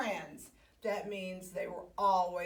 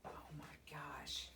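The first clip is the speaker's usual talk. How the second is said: breathless whisper; whispered aside; 7 semitones lower, 16 dB quieter than usual. Real, not acted. Storyteller aside to children, whispering. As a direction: whisper it in wonder.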